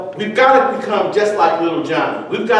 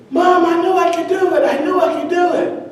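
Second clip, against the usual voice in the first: put-on high, triumphant child voice; proud little boy; 11 semitones higher than usual. Johnny calls to his mom after the dive, high and proud. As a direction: crow it like a beaming five-year-old.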